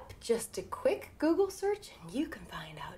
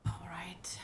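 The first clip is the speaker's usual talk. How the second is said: whisper; 5 dB quieter than usual. Acted. Whispering at seance.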